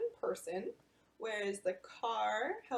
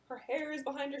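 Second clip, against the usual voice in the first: ordinary delivery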